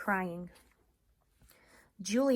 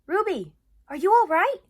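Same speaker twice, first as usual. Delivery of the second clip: higher and louder, urgent concern; worried Ivan; 12 semitones higher, 14 dB louder than usual. Acted. Ivan rushing to check on Ruby, worried. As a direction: ask urgently. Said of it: "I rush to my window".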